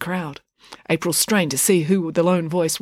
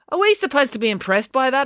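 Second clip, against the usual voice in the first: sarcastic; 8 semitones higher than usual. A narrator's reading. April sneers at Maya.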